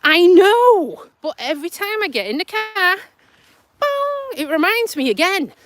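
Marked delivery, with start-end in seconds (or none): 0.0-1.0 slow, fed-up groan of a reply; mock exasperation at the car's reminders; exasperated. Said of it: "And I'm like". 3.8-4.3 high mimicked warning chime; ping it out like a dashboard alert; imitating the car's fuel warning bong.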